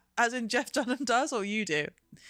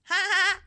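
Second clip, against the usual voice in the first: sudden high laugh; burst of laughter; 10 semitones higher, 5 dB louder than usual. Real, not acted. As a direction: laugh out loud. Laughs at Guinness remark.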